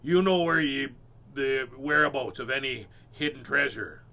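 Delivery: loud pirate hail; calling out. Acted. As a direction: hail the mermaid from the boat. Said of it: "called out".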